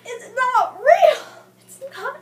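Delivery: furious fan yelling at the page; angry outburst. Retold or imitated. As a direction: shout it like you can't believe the book. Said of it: "I'm so angry because I'm like".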